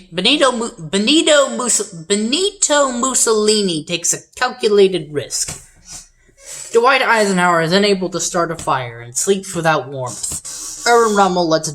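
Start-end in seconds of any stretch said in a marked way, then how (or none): 0.0-5.7 high, slow, drawn-out name; hammy announcer. Shown as a demonstration. Game host restarts Mussolini's line, hammed-up announcing. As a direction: stretch "Benito Mussolini" grandly, pitched up.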